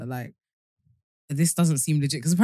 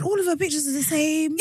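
high, innocent protest; naive sister; 11 semitones higher than usual. Retold or imitated. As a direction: say it as if it proves everything. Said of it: "She said".

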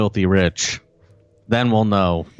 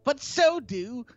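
high, slow, prissy mock reading; high slow mock of a tabloid columnist; mocking posh tabloid voice; 10 semitones higher, 5 dB quieter, slower than usual. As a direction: parody the snooty article writer. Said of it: "Isn't that fun?".

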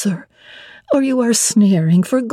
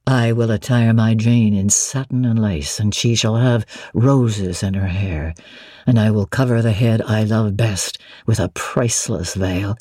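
lower, steady male voice for Mr Rochester; 10 semitones lower than usual. Acted. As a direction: drop into the master's lower register, assured. Rochester voiced by female narrator.

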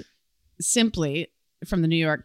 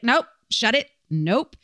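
imitated judge cutting someone off, louder first; mock dismissive; 5 semitones higher, 5 dB louder than usual. Judge stopping the defendant's excuses, mock dismissive. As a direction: shut them down with firm, separate refusals.

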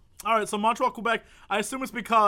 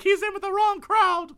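higher mock-worried plea; worried mother; 12 semitones higher, 3 dB louder than usual. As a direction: beg the police. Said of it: "She goes to the police like".

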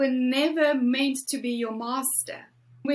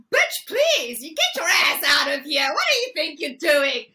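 tough-love shout; 4 dB louder than usual. Retold or imitated. Inner voice scolding her into action, a loud fierce shout. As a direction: yell herself into action like a bossy best friend. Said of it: "my nice voice is like".

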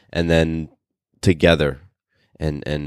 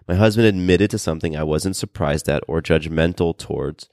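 ordinary talk, nothing marked

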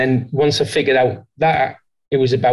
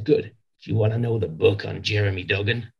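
put-on lower, softer American accent, teasing boss; American accent impression; 5 semitones lower, 6 dB quieter than usual. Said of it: "it's always a John McMahon accent".

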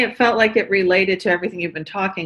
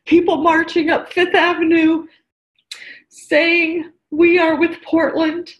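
tearful, pitch raised high; fighting back tears mid-sentence; holding back sobs; 8 semitones higher than usual. Real, not acted. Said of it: "Sorry, I get emotional".

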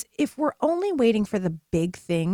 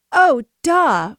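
loud, higher-pitched "obviously" reaction; sudden realisation; 5 semitones higher, 10 dB louder than usual. Retold or imitated. Coach replaying her reaction to therapist's point, sudden realisation. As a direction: act out the penny dropping, loudly.

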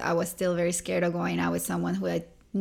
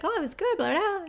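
much higher, singsong chatter; breezy brush-off; 12 semitones higher, faster than usual. Retold or imitated. Telling her cousin about her day, breezy singsong. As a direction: toss it off lightly.

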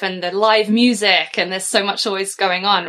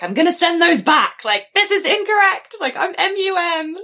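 high-pitched, indignant protest; joking high-voiced complaint; mock outrage; 9 semitones higher than usual. Joking outrage about school cards.

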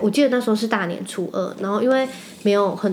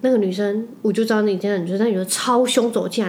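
normal talking voice

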